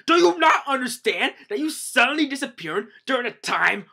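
high, very loud scolding; hammed-up outraged official; acted furious yelling. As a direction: holler at the king in exasperation. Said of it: "the guy's yelling at him".

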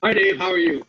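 a low, loud greeting from a big man looking down; big guy greeting. Retold or imitated. Big client looks down and repeats his therapist's greeting, low and loud. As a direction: boom it out from above, stiff and dutiful. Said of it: "he looks down at me and he says".

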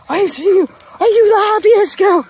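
high, cooing praise. Real, not acted. Owner praising her tired dog in high coo. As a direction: gush over a happy dog.

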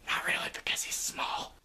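hushed joking aside; whispered aside. Acted. Narrator whispering a joke to the listener, hushed. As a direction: whisper it behind your hand.

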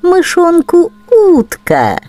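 ordinary delivery, nothing marked

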